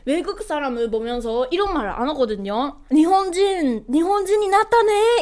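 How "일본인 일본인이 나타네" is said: a lightly put-on surprised voice, a bit higher; light hint of a mock gasp; mock Japanese onlooker. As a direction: play a Japanese bystander spotting a foreigner. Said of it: "이렇게 말하지 않아".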